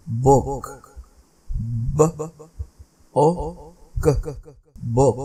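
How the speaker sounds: बुक split into ब, ओ, क then joined; teacher sounding out बुक; sounded out word. Shown as a demonstration. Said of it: "teacher segmenting word बुक".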